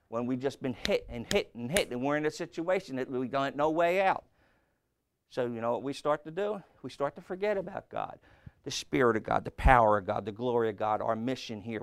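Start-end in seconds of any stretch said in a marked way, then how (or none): none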